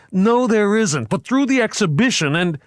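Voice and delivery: high panicked protest; frantic arguing. A narrator's reading. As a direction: cut in desperate.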